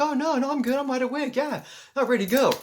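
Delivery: high, loud, overly breezy denial; hammed-up nonchalance to friends; playing it cool. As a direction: fake being totally fine. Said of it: "playing it cool".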